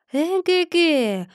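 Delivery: slow knowing eh-heh; knowing drawl. Acted. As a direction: stretch it out slyly, the penny drops. Chub realizes the truth. (лукавое протяжное эге-ге)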